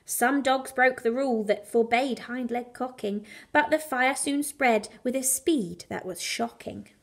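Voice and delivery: plain reading voice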